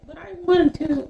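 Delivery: high, mock childish whine; imitating a petulant kid; whiny child imitation. Mocking people who want to say the slur: high childish whine. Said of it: "So we're like".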